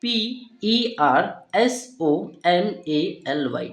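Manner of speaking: letters read slowly one by one; slow spelling. Shown as a demonstration. Teacher reading out the letters of the next word, slow. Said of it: "next word क्या आता है".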